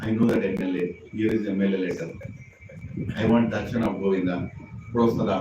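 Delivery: name-drop like a self-important pilgrim; boastful pilgrim. Retold or imitated.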